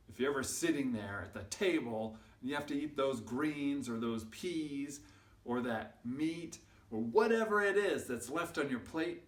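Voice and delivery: usual speaking voice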